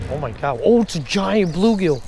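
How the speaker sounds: usual speaking voice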